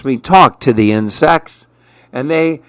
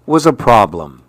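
plain reading voice

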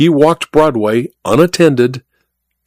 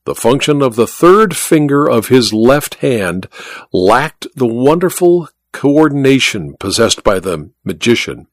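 ordinary delivery, nothing marked

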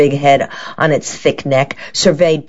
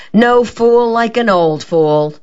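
grumbling; 8 semitones higher, 3 dB louder, slower than usual. A narrator's reading. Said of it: "Matilda grumbled".

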